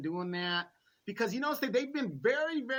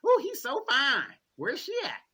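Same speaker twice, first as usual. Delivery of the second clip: gushing fan; 6 semitones higher, 6 dB louder than usual. Retold or imitated. Voicing fans of Biden.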